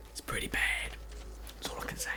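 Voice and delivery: breathy whispered aside. Real, not acted. Whispering to viewers about a bad result. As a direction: drop to a secret whisper. Quietly checking how bad it is.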